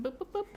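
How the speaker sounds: high "boop" beeps, high-pitched; button beeps. Retold or imitated. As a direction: chirp boop boop boop like a register. Imitating register buttons.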